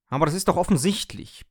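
high, loud know-it-all lecture; lecturing. Acted. Coffee machine lectures its owner, loud and lofty. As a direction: talk down to him like a teacher. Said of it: "belehrte mich die Kaffeemaschine".

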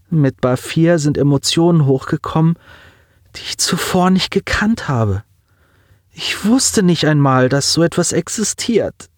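put-on high, strained voice of a grieving boy; a young man fighting back tears; lightly voiced teen on the edge of crying; grieving boy Simon. A narrator's reading.

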